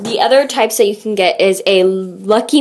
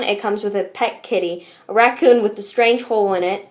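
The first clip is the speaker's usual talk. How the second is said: usual speaking voice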